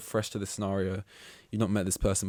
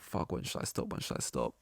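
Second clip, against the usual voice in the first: hushed, breathless inner panic; panicked whisper; 10 semitones lower, 10 dB quieter than usual. Retold or imitated. Muttering to himself mid-ramble, a panicked whisper.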